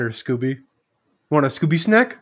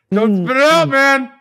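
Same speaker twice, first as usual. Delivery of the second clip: excited; 9 semitones higher than usual. Real, not acted. Friend urging during challenge.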